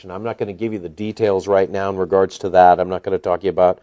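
ordinary talk

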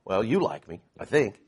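normal talking voice

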